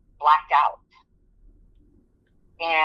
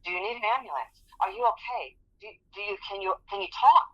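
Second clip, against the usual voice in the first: anxious supervisor; 6 semitones higher, 4 dB quieter, faster than usual. Retold or imitated. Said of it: "she's asking me".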